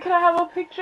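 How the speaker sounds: small, high fangirl request; acted-out nervous high voice; starstruck asking. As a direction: ask your idol in a high squeak. Timid request to her idol.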